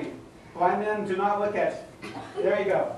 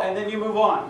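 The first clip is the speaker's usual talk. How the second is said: usual speaking voice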